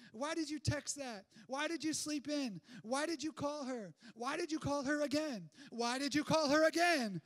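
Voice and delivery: mock self-berating inner voice; self-scolding. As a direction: chew yourself out in disbelief. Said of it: "we thought to ourselves".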